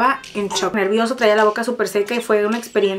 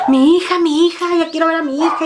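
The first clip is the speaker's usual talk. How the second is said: higher, louder frantic pleading; intense pleading; 6 semitones higher, 3 dB louder than usual. Retold or imitated. New mother frantically begging nurses to see her daughter, high and loud. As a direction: beg loudly and high to see the baby. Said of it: "yo súper intensa que".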